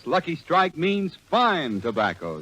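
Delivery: ordinary talk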